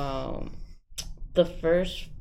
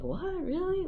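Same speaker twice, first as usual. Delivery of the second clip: high-pitched, incredulous questioning; mock surprise. Speaker voices sceptical people hearing about male survivors, mock surprise. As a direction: imitate a disbelieving reaction, pitched way up. Acting out people's disbelieving reaction.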